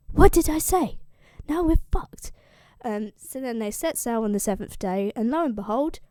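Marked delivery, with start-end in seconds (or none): 0.1-2.3 What did I say yelled, much higher and louder; exasperated shout. Retold or imitated. Odysseus yells at his crew after the whisper, shouting. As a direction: blow up at the crew.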